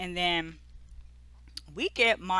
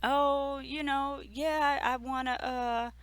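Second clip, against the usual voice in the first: lightly voiced little girl, higher pitch; little daughter; 5 semitones higher than usual. Daughter asks her mother for a baby brother, lightly imitated child voice. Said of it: "Brooklyn is like".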